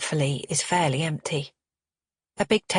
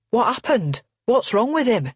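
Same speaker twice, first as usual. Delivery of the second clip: alarmed; 6 semitones higher, 4 dB louder than usual. Acted. Alarmed man at party.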